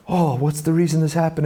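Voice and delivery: asked high and bright with fresh hope, like someone inspired; eager, hopeful question. Retold or imitated. People who turn tragedy into purpose, asking hopefully. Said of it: "some people take that and".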